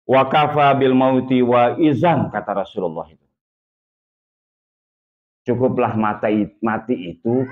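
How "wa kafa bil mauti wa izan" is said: slow, louder solemn recitation; Arabic recitation. Acted. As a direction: recite the hadith slowly. Quotes hadith in Arabic.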